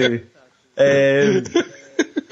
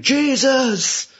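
Nick Cage; 10 semitones higher than usual. Retold or imitated. Cage reacts to his pal getting shot, mock scream. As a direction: imitate an over-the-top horrified cry. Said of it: "the way he screamed".

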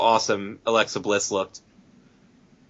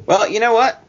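ordinary talk, nothing marked

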